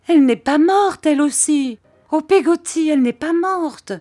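very high, loud, distraught plea; anguished child's outburst; panicked cry. A narrator's reading. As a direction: cry out in dread of a death. Child fears mother is dead.

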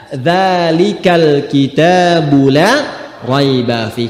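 "Dzalikal kitabu la raiba fi" recited slowly, dzal from the tongue tip; slow recitation drill. Shown as a demonstration. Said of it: "Maka dimulai dengan za". (lantunkan "Dzalikal kitabu la raiba fi" perlahan, dzal jelas)

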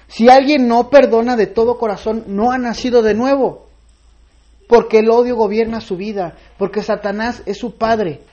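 a preacher warning that the unforgiving are not born again; fervent preaching. Acted. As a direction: press the congregation hard on forgiveness. Preaches forgiveness at full force.

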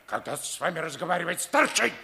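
angry command. Acted. German colonel silencing the captured lieutenant, angry shout. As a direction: cut the prisoner off with a shouted command.

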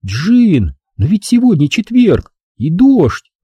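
put-on young woman, uneasy; Angela's voice. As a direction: voice the worried girlfriend. Angela's voice in narration.

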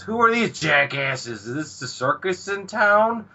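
high-pitched mock outrage; acted indignation. Hijikata sizing up the strange exorcists, indignant.

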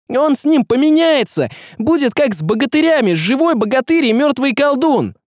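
high-pitched frantic warning; shouted. A narrator's reading. Young man explaining the sorcerer's swap, shouting. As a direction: blurt it out high and urgent. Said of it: "закричал он".

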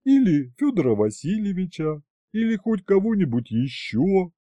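high, put-on dragoness voice; eager dragoness. A narrator's reading. She-dragon offering to eat grandfather, high-voiced.

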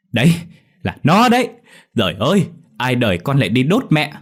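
loud, high accusing wail; noisy bawling. A narrator's reading. Mụ Lành accusing Dậu before villagers, bawling. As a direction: cry out for everyone to hear. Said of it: "khóc bù lu bù loa lên".

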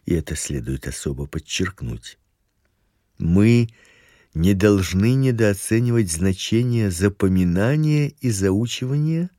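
normal talking voice

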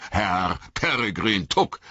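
put-on farmer recognising a young acquaintance; hearty surprised greeting; surprised exclamation. A narrator's reading. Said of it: "rief er".

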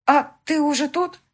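put-on high, loud, slow child's voice; young daughter amazed her mother is there; N=Alisa/character; surprised little girl. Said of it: "удивилась Алиса".

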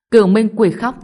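loud shout of an attack name; shouted battle cry. A narrator's reading. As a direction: yell your special move at the enemy. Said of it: "Thạch Trung Nguyên hét lên".

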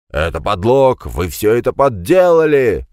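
loud, rising angry shout; вскакивает и кричит на следователей. A narrator's reading. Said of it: "крикнул Жиль". (громкий гневный крик)

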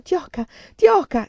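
high, loud, slow calling out; desperate shout. A narrator's reading. Woman calling out to frightened child upstairs.

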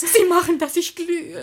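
high, loud coy gush; coy blushing. Acted. Witwe tut errötet, hoch und laut.

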